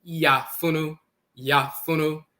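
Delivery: yafunu as yah-foo-noo; pronounced yafunu. Shown as a demonstration. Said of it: "pronounced and written in Akan as".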